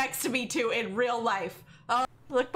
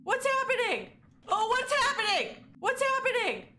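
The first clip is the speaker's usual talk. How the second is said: anxious; 10 semitones higher than usual. Real, not acted. Game events frighten him.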